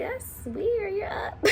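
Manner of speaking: high, light mock-mom voice through a laugh; a playful hint of surprised mom; imitating mom. Mom finding her awake at dawn, mock-surprised high voice. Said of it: "And she's like".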